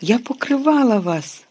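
loud, high shriek of denial. A narrator's reading. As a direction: screech it, desperate to be believed. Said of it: "заверещала Герміона".

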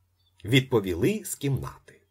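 usual speaking voice

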